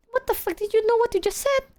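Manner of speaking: high-pitched scandalized questioning; incredulous high retold reaction; shocked disbelief. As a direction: squeal it in mock horror.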